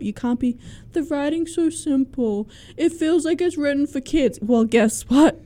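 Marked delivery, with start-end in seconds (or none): 0.9-4.4 higher-pitched imitation of a complainer; mock complaining reader. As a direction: mimic an adult griping about a kids' book. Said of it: "if you're reading this as an adult".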